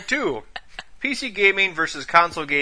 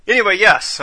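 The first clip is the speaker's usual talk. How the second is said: usual speaking voice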